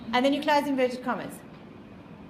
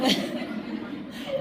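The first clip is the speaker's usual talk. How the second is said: high airy giggle; high breathy laugh; 12 semitones higher than usual. Real, not acted. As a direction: giggle at the irony. Laughing at own irony.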